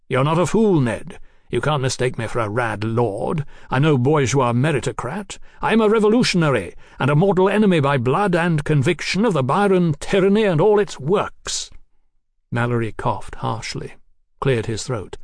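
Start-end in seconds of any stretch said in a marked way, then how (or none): 0.1-11.7 emphatic, rising into higher declaiming; zealous revolutionary Marquis. Acted. Marquis declaring himself enemy of tyranny, zealous and mounting. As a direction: build to a defiant oath.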